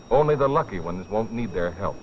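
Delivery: usual speaking voice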